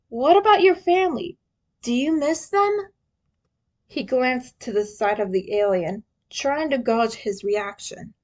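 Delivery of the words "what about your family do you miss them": put-on curious young hero. A narrator's reading. Leo asks gently.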